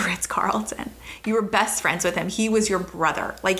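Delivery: ordinary talk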